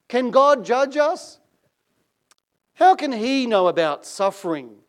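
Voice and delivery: high, loud indignant snap; snapped. Acted. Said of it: "snapped a pert young brunette".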